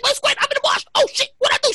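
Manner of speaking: high, frantic retold confession; mock panic. Son tells mom he's at a protest, high mock panic. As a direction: blurt the news in hammed-up alarm. Replays panicked phone call.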